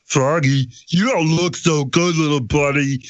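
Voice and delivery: high, slow dopey cartoon cat voice; stupid voice. Shown as a demonstration. Voice actor demoing his dopey Big the Cat voice, high and slow. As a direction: sound dim and lost, drawn out. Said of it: "keep doing that same voice right there".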